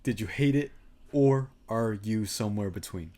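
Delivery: normal talking voice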